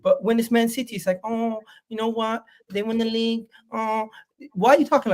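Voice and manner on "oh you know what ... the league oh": mock meek. Co-host mocks pundit going easy on Man City, mock meek. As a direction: drop the bass out and imitate half-hearted conceding. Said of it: "speak with a little more bass in your voice".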